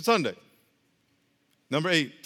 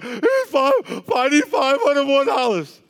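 higher, louder desperate plea, voice crying; panicked praying about rent; anxious crying prayer; 8 semitones higher, 5 dB louder than usual. Acted. A broke believer begs God for rent money, crying. Worried person answers Jesus.

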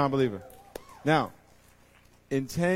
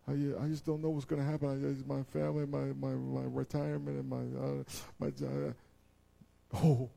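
anxious neighbor; 3 semitones higher, 5 dB quieter than usual. Retold or imitated. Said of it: "you know what they're saying".

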